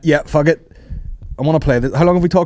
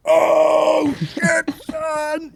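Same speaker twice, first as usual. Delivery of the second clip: high, drawn-out mock holler of amazement; excited courtside fan; much slower than usual. Podcaster mimics Nicholson cheering Lakers baskets, high excited holler. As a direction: whoop it out like he loves it. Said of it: "and he's like".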